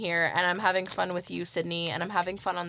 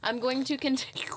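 whisper; 4 semitones higher than usual. Pretend. Whispered callback joke.

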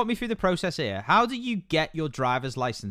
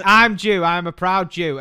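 proud boast; 6 dB louder, slower than usual. Retold or imitated. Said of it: "You're like".

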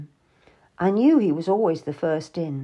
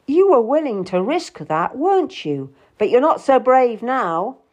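cutting, emphatic taunt; cold; 5 semitones higher, 5 dB louder than usual. A narrator's reading. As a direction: taunt her coldly. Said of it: "He looked at me coldly and said".

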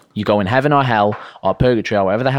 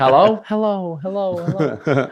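slow higher hellos echoing back; echoey room; 5 semitones higher than usual. Pretend. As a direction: call out as if into an echo. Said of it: "He was just in an echoey room".

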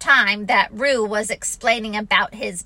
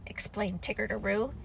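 plain reading voice

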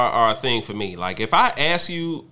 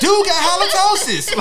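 laughing; 5 dB louder than usual. Real, not acted. Laughing tease about bad breath.

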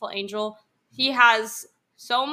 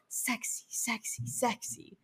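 very soft, unvoiced whisper; hushed mock-seductive repeat; 14 dB quieter, slower than usual. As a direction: whisper each word separately. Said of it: "like, from O D B".

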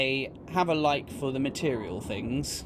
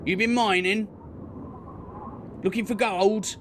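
orc bandit; 3 semitones higher than usual. Acted. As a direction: press them like a greedy orc thug. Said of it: "he goes".

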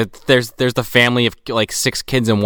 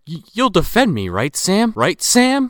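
higher-pitched, needy mock pleading; anxious retold begging, rising on the name; nervous plea; 9 semitones higher, slower than usual. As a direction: lift it high, seeking backing from a pal. Pleading quote in movie retelling.

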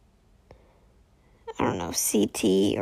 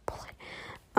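laughing whisper; 12 dB quieter than usual. Real, not acted. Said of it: "or put it".

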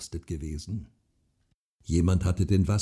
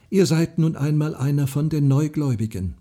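plain reading voice

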